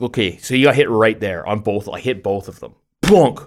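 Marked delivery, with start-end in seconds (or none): 3.0-3.4 loud, high imitated plunk; punchy cartoon-style thunk; imitated impact. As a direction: make the sound of a ball hitting. Imitates ball hitting son.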